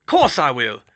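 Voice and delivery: loud rustic big-man voice; gruff, robust confidence; Hagrid, confident. Acted. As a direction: assure them with hearty bravado.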